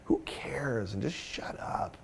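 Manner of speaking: slow, whispered contempt for yourself; harsh inner critic, all in a whisper; self-berating whisper. Retold or imitated. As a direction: beat yourself up under your breath. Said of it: "beat up on myself and go".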